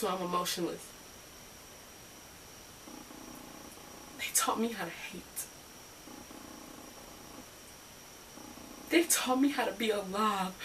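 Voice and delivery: hushed, halting confession with long pauses; acted quiet hurt after an outburst; Carolyn, broken soul. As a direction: let the voice sink, spent and wounded. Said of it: "Carolyn is a broken soul".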